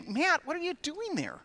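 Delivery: put-on high, surprised parent calling to a child; mock startled parent. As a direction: sound caught off guard finding him there. Parent voiced in story.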